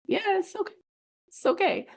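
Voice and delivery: high, easygoing "it's okay" without a care; breezy shrug. Pretend. Coach shrugging off chaos, breezy and high. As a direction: toss it off lightly, nothing bothers you. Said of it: "you just showed up and you're like".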